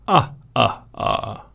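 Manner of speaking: lightly voiced campy vampire counting; half-hearted vampire laugh, slow. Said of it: "I wasn't doing the Count very seriously".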